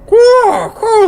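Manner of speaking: very high cawing bird call; raven caws. Retold or imitated. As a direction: caw like a raven, loud and high. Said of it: "all the ravens outside go".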